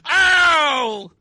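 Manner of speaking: loud, high cartoonish "ow"; mock yelp of pain. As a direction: yelp like a kid who fell off the bed. Acted child hurt jumping.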